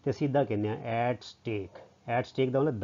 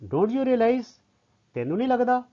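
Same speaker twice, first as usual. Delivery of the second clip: high, louder acted reproach; disapproving grumble; 10 semitones higher, 5 dB louder than usual. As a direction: challenge them, pitch shooting up. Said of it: "ਉਹਨੇ ਬੁੜਬੁੜਾ ਕੇ ਇੱਦਾਂ ਕਿਹਾ".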